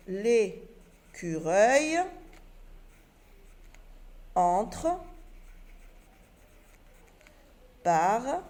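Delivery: each word stretched out, long pauses between; slow dictation. Shown as a demonstration. Teacher to pupils, dictating the rewritten sentence word by word. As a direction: dictate word by word while writing. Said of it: "et je réécris ma phrase".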